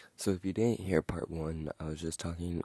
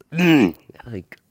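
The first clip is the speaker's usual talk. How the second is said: loud exasperated groan; frustrated mm; 7 semitones higher, 13 dB louder than usual. Real, not acted. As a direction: let out a strangled frustrated hum. Frustrated noise while ranting.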